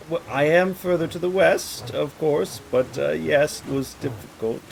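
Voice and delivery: lightly voiced waffling lord; evasive baron. Baron excuses himself to the liege, high and evasive.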